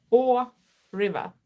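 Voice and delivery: normal talking voice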